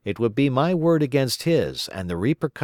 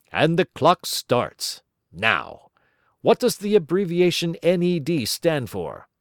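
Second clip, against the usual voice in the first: quiz host; 7 semitones higher than usual. Pretend. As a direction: pose the question like a TV quizmaster.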